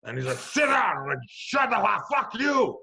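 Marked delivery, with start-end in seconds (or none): 0.4-1.0 high, loud angry cop shout; shouted order. Retold or imitated. Fat cop orders a handcuffed man to sit, shouting. Cop's order repeated in escalating quarrel. 1.3-2.8 high, loud yell back at the cop; shouted retort. Retold or imitated. Handcuffed man answers the cop's order, shouting back.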